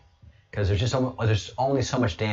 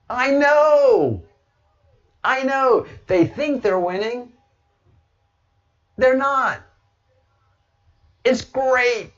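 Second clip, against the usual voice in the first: 1 mocking high voice; 6 dB louder, slower than usual.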